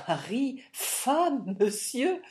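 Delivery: stupéfaite, plus haute et plus forte; s'étrangler de surprise sur chaque mot; cri stupéfait. A narrator's reading. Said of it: "cria la veuve toute stupéfaite". (veuve stupéfaite qui s'écrie, aiguë et forte)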